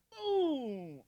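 loud, drawn-out high falling "boom"; imitated thunder boom. Preacher imitating the second thunderclap, booming loudly. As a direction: let the boom ring out and drop. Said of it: "And I heard it again".

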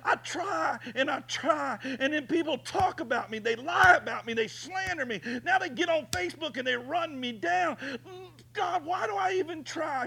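high, loud anguished pleading; crying out to God. Retold or imitated. Preacher retelling his desperate prayer in the yard, anguished high cry. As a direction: wail your despair up to heaven. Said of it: "And I'm saying".

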